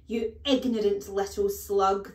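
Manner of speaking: loud, furious bellow; bellowed insult. A narrator's reading. Headmistress raging at small boy, bellowing. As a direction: hurl the insult at full volume. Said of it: "the Trunchbull bellowed".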